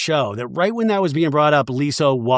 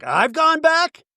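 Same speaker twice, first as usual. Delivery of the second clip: much higher, slow hammed-up disbelief; mock indignant; 13 semitones higher, slower than usual. Mary retorting to Angie, mock indignant disbelief. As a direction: bristle like an insulted friend. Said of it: "Mary's like".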